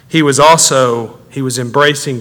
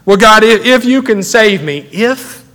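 high-pitched, incredulous quoting; mock doubtful prayer; 7 semitones higher than usual. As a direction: parody a hesitant prayer, pitched up. Mocking doubtful prayers to God.

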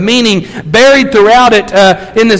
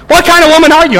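shocked husband; 7 semitones higher, faster than usual. Acted. Husband shocked by old boyfriends.

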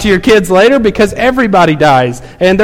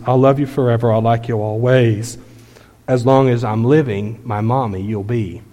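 much softer, low, grieving lullaby; sad soft song; 6 semitones lower, 6 dB quieter than usual. Retold or imitated. Grown son singing to his silent dying mother, soft and sad. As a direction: sing it quietly over a dying mother. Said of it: "the little boy continues".

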